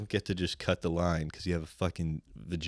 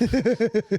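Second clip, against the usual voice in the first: short burst of laughter; 6 dB louder than usual. Real, not acted. Laughing mid-sentence about filling time.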